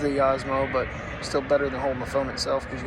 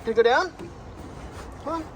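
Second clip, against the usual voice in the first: urging the dog; 12 semitones higher than usual. Real, not acted.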